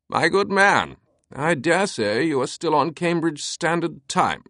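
put-on thick British accent, high and light; crisp British accent. A narrator's reading. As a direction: play the crisp British eccentric. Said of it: "The voice was crisp and light".

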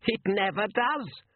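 a hint of an old hag, slow; slow, high crone voice; cackling old woman. A narrator's reading. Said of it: "cackled the old woman".

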